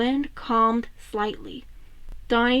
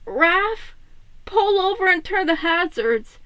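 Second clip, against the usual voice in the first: urgent; 7 semitones higher than usual. Acted. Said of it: "Leo urging Raph".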